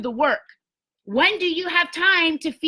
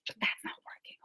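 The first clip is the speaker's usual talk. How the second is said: whisper; 17 dB quieter than usual. Real, not acted. Joking aside about her chest.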